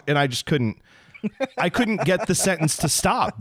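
ordinary delivery

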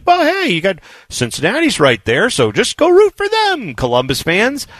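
high, sing-song fake helpfulness; mocking high-pitched pep; mock cheery consolation. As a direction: pitch it up, stretched and patronizing. Mocking the league's attitude.

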